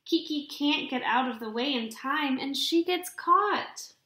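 plain reading voice

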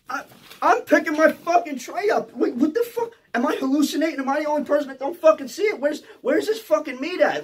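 high, loud bewildered panic; hammed-up frantic disbelief, pitched high; freaking out. Inmate searches his food tray in confusion, freaking out. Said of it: "I'm freaking out".

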